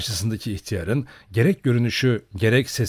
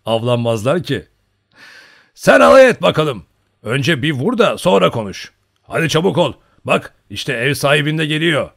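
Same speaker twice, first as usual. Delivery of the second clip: loud, raised, hearty retort; rowdy old hunter hurrying him; boisterous old hunter; 5 semitones higher, 9 dB louder than usual. A narrator's reading. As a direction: brush off the teasing in a big voice. Old hunter answers back loudly.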